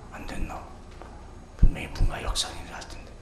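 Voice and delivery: hushed worried muttering to himself; anxious whisper. Retold or imitated. Pastor voicing his own worry while waiting, whispered. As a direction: wonder nervously under your breath.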